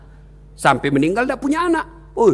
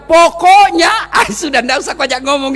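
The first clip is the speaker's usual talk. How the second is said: loud, high mock insistence; emphatic, hammed-up nagging; nagging wife; 7 semitones higher, 7 dB louder than usual. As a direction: imitate a wife putting her foot down. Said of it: "kalau dia sudah bilang".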